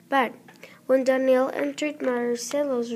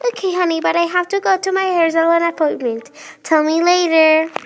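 louder, higher mimicry of mom; mocking mom imitation; 3 semitones higher, 5 dB louder than usual. Girl mocking her busy mother, louder and higher. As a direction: parrot mom back in a mocking singsong. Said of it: "mimicked her mother".

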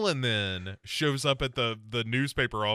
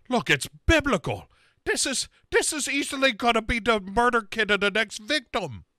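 mock Sarah; 9 semitones higher, 4 dB louder than usual. Mocking Sarah's reasoning.

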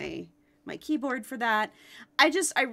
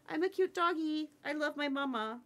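lightly voiced cute dog, higher and softer; voicing the dog; 6 semitones higher, 4 dB quieter than usual. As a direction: speak for the puppy in a sweet little voice. Voicing her dog Pretzel.

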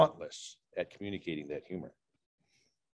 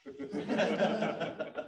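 laughing at own joke; 6 semitones higher, 7 dB quieter than usual. Real, not acted. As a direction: chuckle at own pun.

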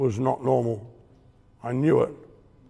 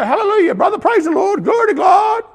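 much higher, much louder shouted hallelujah; over-the-top mock preacher; 8 dB louder than usual. Imitating a churchgoer gushing at a stranger, hammed-up shout. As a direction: ham up a gushing churchgoer praising the Lord. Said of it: "some would go".

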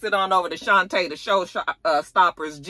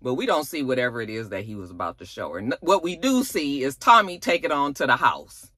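usual speaking voice

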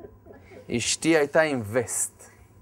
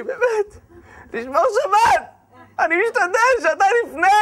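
much higher, loud, laughing complaint; exasperated plea; 7 dB louder than usual. Retold or imitated. Man pleading with God amid the mess, exasperated. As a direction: cry out to heaven half joking.

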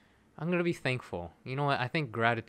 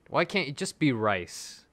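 normal talking voice

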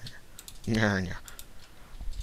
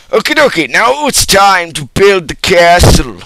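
bright, loud, high burst of glee; excited; 7 semitones higher, 13 dB louder than usual. Real, not acted. Gamer kicking off the castle build, loud and excited. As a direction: announce the build loudly and gleefully.

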